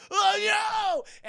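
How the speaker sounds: a high, loud, panicked cry of oh no; panicked scream. Acted. Oil truck driver sees the slick, panicked shout. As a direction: scream it as disaster hits.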